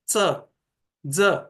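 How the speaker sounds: च as ts and ज as dz, tongue touching teeth; slow च like ts, then ज like dz; Marathi ch and j. Shown as a demonstration. Teacher demonstrating regional च and ज, slowly. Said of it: "इन दोनों को मिलाकर उसका उच्चारण करते हैं".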